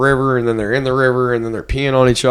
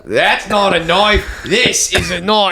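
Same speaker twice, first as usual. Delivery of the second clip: slow, higher-pitched, hammed-up Aussie; mock Australian accent; 5 semitones higher, slower than usual. Quoting a famous Aussie knife line, mock Australian accent. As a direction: draw out the vowels in a broad Australian accent. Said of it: "What other Australian stereotypes do we know?".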